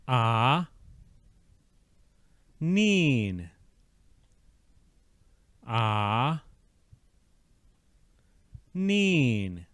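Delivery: "Ah" then "Neen", falling each time; syllable drill. Shown as a demonstration. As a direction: alternate "Ah" and "Neen" for repetition. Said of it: "The syllables for this word".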